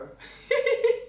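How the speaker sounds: high burst of laughter; laughing at herself. Real, not acted. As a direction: laugh out at her own accent. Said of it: "Quel accent!".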